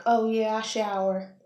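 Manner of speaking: loud, breezy fake-glam "oh yeah"; mock glam influencer. Vlogger mocking made-up beauty videos, loud glam voice. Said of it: "face full of makeup like".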